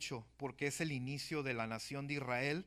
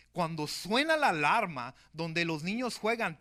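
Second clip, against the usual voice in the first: louder, impassioned sermon voice, pressing each point; rising pulpit fervor about a nation at war; fervent preaching; 4 semitones higher, 7 dB louder than usual. Acted. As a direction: preach it to the congregation with urgency. Preaching at full force.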